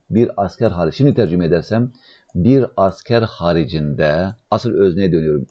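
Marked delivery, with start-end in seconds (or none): 2.3-4.3 very slow, drawn-out word endings; teacher's slow, measured translation; slow dictation. Shown as a demonstration.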